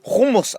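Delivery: HUMMUS said as KHOO-moos, high and falling; emphatic pronunciation. Shown as a demonstration. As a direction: hit HUMMUS with an OO in both syllables. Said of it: "Not hummus".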